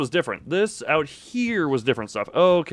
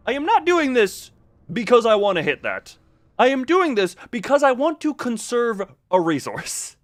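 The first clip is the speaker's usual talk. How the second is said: insistent denial; 8 semitones higher than usual. Real, not acted. Defends his shooting choice.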